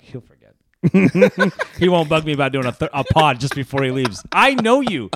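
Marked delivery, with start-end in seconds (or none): 0.0-0.5 whisper. Retold or imitated. Retold friend's aside.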